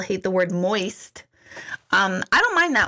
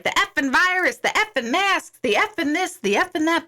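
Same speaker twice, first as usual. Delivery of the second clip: mock ranting brother, pitched higher; lightly voiced brother venting about everything; imitating brother's rant; 7 semitones higher than usual. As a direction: rattle off his complaints like a worked-up brother. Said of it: "he was like".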